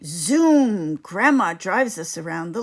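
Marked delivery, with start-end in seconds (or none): none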